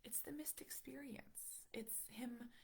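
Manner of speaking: soft, hushed sadness; real quiet sorrow; sad. Real, not acted. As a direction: speak quietly, holding the hurt in. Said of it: "the part that I'm sad about".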